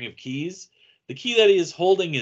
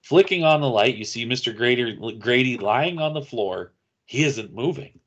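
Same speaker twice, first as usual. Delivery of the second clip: ordinary talk, nothing marked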